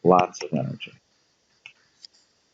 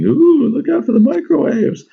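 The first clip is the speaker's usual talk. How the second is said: high, loud spooky mock warning; mock fear; 5 dB louder than usual. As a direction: ham up a scary warning.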